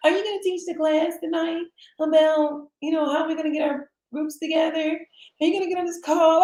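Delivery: high, quick eager questions; high-pitched asking voice. Retold or imitated. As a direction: rattle off requests brightly. Imitating people asking her.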